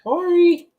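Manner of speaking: very high, loud call. Real, not acted. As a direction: sing out the name to a pet. Calling the bird.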